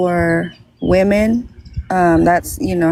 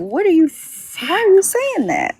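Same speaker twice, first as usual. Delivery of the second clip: much higher, exasperated why; indignant outburst; 10 semitones higher than usual. Retold or imitated. Replaying her retort to someone telling her to calm down, exasperated. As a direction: throw the question back in disbelief.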